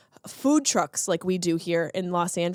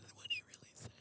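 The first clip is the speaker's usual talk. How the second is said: whisper; 26 dB quieter, faster than usual. Real, not acted. Conspiratorial aside before punchline.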